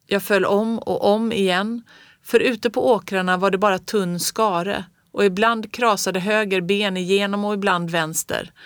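plain reading voice

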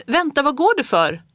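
high calling voice. A narrator's reading. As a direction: call after a boy slipping out the door. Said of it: "ropade hon".

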